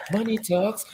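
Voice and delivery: money talks up high. Shown as a demonstration. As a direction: lift it into a high squeak. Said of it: "repeated in new voice".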